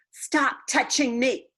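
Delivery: high, loud slow yell; hollering. A narrator's reading. Lucille yelling at Junie B, loud high holler. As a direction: yell right in her face. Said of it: "she hollered right in my face".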